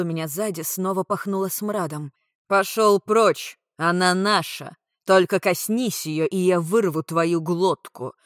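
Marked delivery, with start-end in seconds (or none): 2.5-8.1 loud slow furious growl; growling through teeth. A narrator's reading. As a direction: snarl the threat, louder and slower. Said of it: "сквозь зубы прорычал Ксар".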